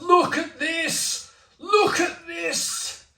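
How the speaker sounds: very high, slow, drawn-out presenter's exclamation; Jeremy Clarkson impression. Beer reviewer showing off his glass, Jeremy Clarkson impression. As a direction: show off the beer in a hugely high, stretched-out TV presenter voice. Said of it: "I'm doing my best Jeremy Clarkson voice".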